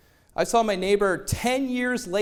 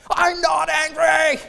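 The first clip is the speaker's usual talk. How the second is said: slow, higher shout; shouted denial, higher and slow; angry denial; 8 semitones higher, 7 dB louder, slower than usual. Retold or imitated. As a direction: yell that you're not angry. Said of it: "we're like this".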